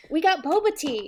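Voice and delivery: high, loud, gleeful announcement; bright celebratory cry; excited toast. Real, not acted. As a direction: cheer it out with delight. Toasting with boba tea.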